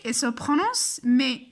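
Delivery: ordinary talk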